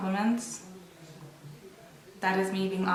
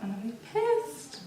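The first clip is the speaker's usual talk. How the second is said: whispered annoyed aside; 6 semitones higher, 5 dB quieter than usual. Real, not acted. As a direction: whisper it under your breath. Muttered aside about a video.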